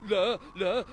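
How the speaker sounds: loud startled no; alarmed shout. Acted. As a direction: burst out in alarm. Sudden alarmed refusal.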